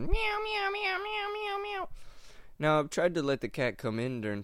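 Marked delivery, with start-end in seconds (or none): 0.0-1.9 very high squeaky cat meows, over and over; imitating a cat. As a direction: mimic a cat crying nonstop outside. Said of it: "the cat is just looking at me going".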